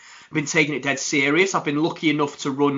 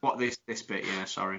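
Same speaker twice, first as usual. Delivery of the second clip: sheepish mumble; 10 semitones lower, 13 dB quieter than usual. Real, not acted. Sheepish after being teased.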